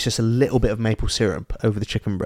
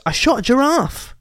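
high-pitched comic announcement; boasting cousin; 11 semitones higher than usual. Acted. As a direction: announce it proudly, pitched right up. Voicing cousin's boastful confession.

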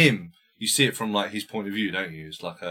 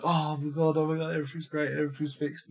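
higher, softer put-on gushing; mock relieved family; 5 semitones higher, 4 dB quieter, faster than usual. Family hears the boy upstairs, mock relief. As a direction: gush softly in a pretend happy voice.

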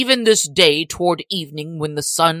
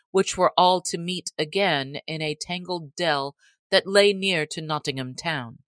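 usual speaking voice

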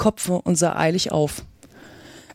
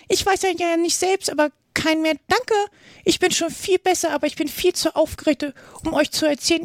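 high, weak little squeak; small squeaky voice; 8 semitones higher than usual. A narrator's reading. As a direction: squeak it out like a shaken little lizard. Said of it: "schwache, quiekende Stimme". (schwache, quiekende Stimme)

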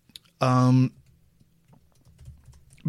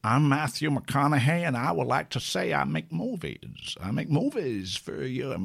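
Matthew McConaughey; 4 dB quieter than usual. Shown as a demonstration. Host quotes Andy's McConaughey line, soft and drawled. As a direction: purr it out like a lazy Texan film star. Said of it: "Andy said to me".

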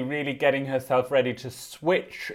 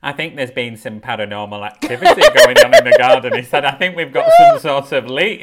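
laughing retelling; 10 dB louder than usual. Retold or imitated. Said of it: "Ken was like".

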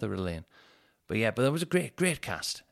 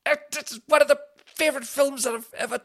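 very high, loud disbelief; stunned reply to his partner's complaint; shocked outburst; 6 dB louder than usual. Retold or imitated. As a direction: squeal it in outraged disbelief. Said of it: "I was like".

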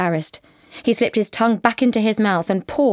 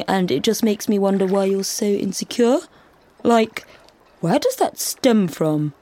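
faux-concerned probing; 2 semitones higher than usual. A narrator's reading. Carl provoking tears.

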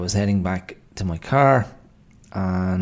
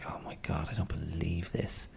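hushed whisper, much softer; disbelieving whisper; 2 semitones lower, 11 dB quieter than usual. Retold or imitated. Reliving the refused refund, whispered disbelief. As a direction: breathe it out in disbelief.

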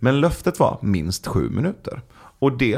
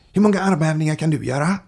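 lightly imitated kid, pitched up; higher-pitched challenge from a curious child; seven-year-old boy; 8 semitones higher than usual. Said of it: "så plötsligt så säger sonen". (lätt härmad sjuårig pojke)

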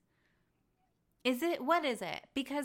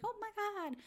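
high, breathy airhead gasp; quoting Lisa falling for the dog excuse, high mock gasp; mock gasp of shock; 13 semitones higher, 5 dB quieter than usual. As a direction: imitate a gullible friend falling for it.

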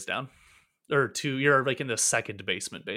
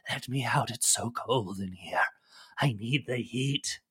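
hissing, rasping lizard voice that swells to wild shouts; a cold, furious captive beast begging to be let out; raging lizardfolk prisoner, pleading for heat; angry trapped lizard creature; 7 dB louder, slower than usual. Acted. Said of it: "clearly angry and irate, cursing".

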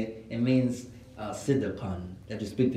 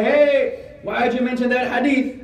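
loud, high-pitched challenge; indignant objection; 11 semitones higher, 8 dB louder, slower than usual. Acted. Sister objecting after Jumu'ah about a hadith, indignant. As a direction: confront the speaker, voice raised high.

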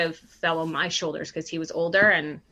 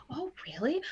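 whisper; 8 semitones higher, 8 dB quieter than usual. Retold or imitated.

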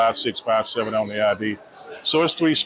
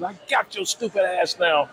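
higher, triumphant cop voice, lightly done; gloating cop; 7 semitones higher than usual. Retold or imitated. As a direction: crow it as the coworker grabbing her. Coworker Conley quoted.

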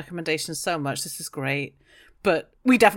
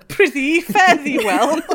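theatrical archaic farewell, louder, with a laugh; hammy stage actor; 8 semitones higher, 8 dB louder than usual. Pretend. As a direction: ham it up like a Shakespearean player. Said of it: "Do you all wanna say just farewell?".

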